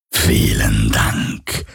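very low, drawn-out phantom; slow, deep voice of a ghostly tormentor. A narrator's reading. Phantom to its victim Galen, deep and drawn out.

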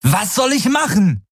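high yelled question full of despair; angry, desperate shout. Acted. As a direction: throw the question back in fury. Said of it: "rief er, ohne seine eigene Wut oder Verzweiflung zu verhehlen".

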